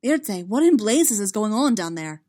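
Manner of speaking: loud, urgent demand over the battle; a loud, rattled admiral demanding answers. Acted. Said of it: "Emil demanded".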